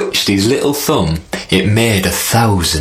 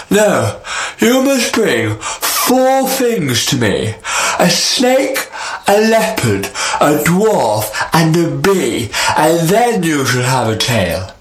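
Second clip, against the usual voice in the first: sky king; 7 semitones higher than usual. Acted. Sky king sets the task.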